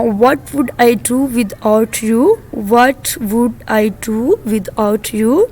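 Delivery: drawn-out question with a rise at the end; slow classroom English; slow model reading. Shown as a demonstration. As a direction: model it slowly, like a teacher. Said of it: "model sentence twice".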